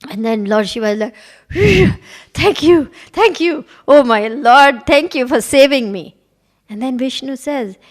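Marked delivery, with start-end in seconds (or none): 1.5-6.2 mock relieved thanks; relieved gratitude. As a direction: gasp out thanks like you just escaped. Relieved Shiva thanking Vishnu.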